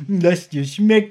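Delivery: slow, doting singsong; mock coddling. Mocking the detectives' pampering, slow and doting. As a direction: coo it like feeding a child. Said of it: "So dieses verhätschelnde".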